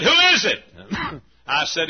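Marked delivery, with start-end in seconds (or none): 0.0-0.6 loud, high holler back; calling out. Retold or imitated. Man under his car answers a visitor, hollering. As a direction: shout the question from under a car.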